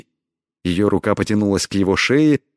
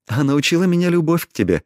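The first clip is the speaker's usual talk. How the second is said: high, quick, playful reply; lightly amused; 8 semitones higher, faster than usual. A narrator's reading. Heroine teases her lover, lightly amused. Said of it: "рассмеялась она".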